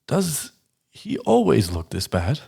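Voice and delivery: a hint of a curious old gnome, drawn out; a touch of a gnome sizing him up. Gnome voiced by GM.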